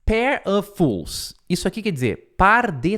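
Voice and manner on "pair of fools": each word spaced out slowly; slow model phrase. Shown as a demonstration. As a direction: teach it syllable by syllable. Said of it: "Primeiro aqui, ó".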